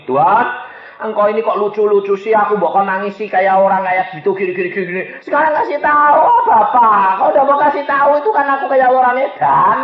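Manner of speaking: put-on high, insistent whine of a child pestering Dad; anak kecil merengek. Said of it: "seperti anak kecil ngerengek sama bapak". (merengek manja seperti anak kecil, suara tinggi)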